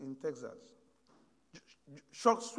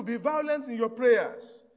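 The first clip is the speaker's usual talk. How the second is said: forceful preaching; 7 dB louder, slower than usual. Acted. Preacher exhorts congregation.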